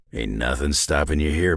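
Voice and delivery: ordinary talk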